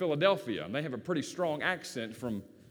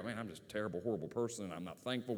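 lower, softer imitation of a downcast churchgoer; mock self-reproach; 6 semitones lower, 7 dB quieter, faster than usual. Churchgoer blaming themselves after the sermon, quiet mock self-reproach. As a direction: drop low and soft, as if owning up. Voices a guilt-ridden listener leaving.

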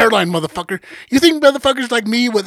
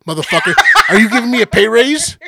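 laughing retort; 4 semitones higher, 6 dB louder than usual. Retold or imitated. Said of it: "I was like".

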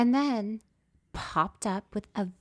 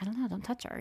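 hushed warning; 2 semitones higher, 6 dB quieter, faster than usual. Retold or imitated. Warning not to wake her.

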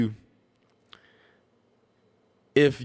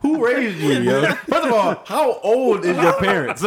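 higher, laughing disbelief; mock disbelief; 4 semitones higher than usual. Teasing a teen who talks old. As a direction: call him out, half-laughing. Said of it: "I would've been like".

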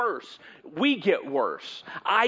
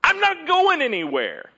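gloating shout; 10 semitones higher, 5 dB louder than usual. Retold or imitated. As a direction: crow it back at the fool on the roof. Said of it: "throw its head back and say".

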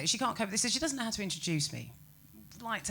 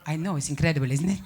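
put-on Spanish accent; 3 semitones lower, 5 dB louder than usual. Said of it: "a very strong accent like this".

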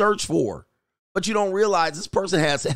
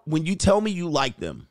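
normal talking voice

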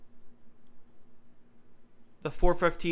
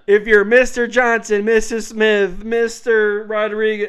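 loud, higher boarding call; over the intercom; 5 semitones higher, 10 dB louder than usual. Retold or imitated. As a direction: blare the names over the speakers. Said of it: "they go, they go".

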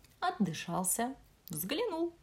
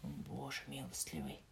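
soft sigh; 6 semitones lower, 12 dB quieter than usual. Acted. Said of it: "ответил Аскелат".

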